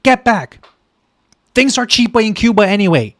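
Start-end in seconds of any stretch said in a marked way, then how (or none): none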